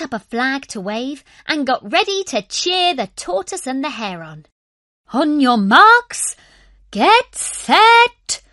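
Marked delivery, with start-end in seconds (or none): 5.1-8.4 very high, loud, slow starting call; the owl starting the race; race starter call. A narrator's reading. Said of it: "called Wallace".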